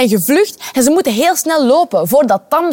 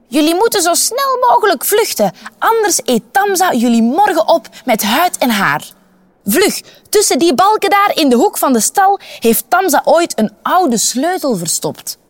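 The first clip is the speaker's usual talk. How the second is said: higher, odd donkey voice, urgent; a helpful donkey hurrying the children; 6 semitones higher than usual. Acted. (ezel die de kinderen dringend waarschuwt, hogere stem)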